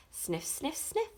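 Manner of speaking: quick soft sniffs, rising; sniffing sounds. Acted. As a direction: sniff like a dog on a scent. Dog sniffing books.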